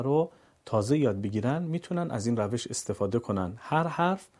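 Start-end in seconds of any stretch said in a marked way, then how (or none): none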